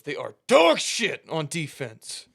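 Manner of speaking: mock furious halftime verdict, dragged out; imitated coach chewing out his offense, slow and loud; pissed-off coach. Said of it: "and he's pissed off".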